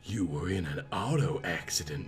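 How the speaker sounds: soft, low Southern nurse voice; sexy Southern accent. A narrator's reading. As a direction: reassure him like a Southern belle nurse. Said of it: "accent of a sexy southern peach".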